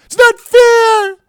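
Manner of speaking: very high, loud, slow whine on "It's not fair"; high whining. Retold or imitated. Host mocks complainers, whining "It's not fair" high and loud. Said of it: "whining and complaining".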